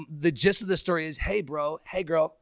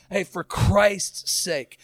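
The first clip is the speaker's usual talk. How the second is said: drawn-out refrain; 2 semitones higher, 4 dB louder, much slower than usual. Acted. Repeated refrain.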